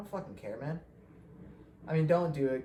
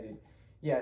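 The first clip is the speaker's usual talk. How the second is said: normal talking voice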